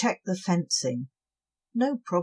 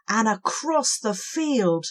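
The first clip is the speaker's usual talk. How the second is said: usual speaking voice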